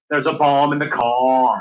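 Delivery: slow, broad Belfast accent. Shown as a demonstration. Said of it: "qualified to say".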